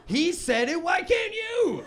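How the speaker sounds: high, loud, drawn-out wail; squealed comic complaint; mock protest. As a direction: shout it indignantly, stretching the end.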